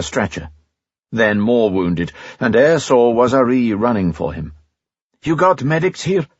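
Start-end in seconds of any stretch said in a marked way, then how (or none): 5.2-6.3 higher, pressing question; urgent. A narrator's reading. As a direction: ask it fast and urgent over the noise. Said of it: "he said urgently".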